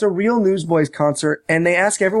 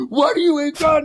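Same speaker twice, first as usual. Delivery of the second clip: high mock demand; 10 semitones higher than usual. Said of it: "he's like".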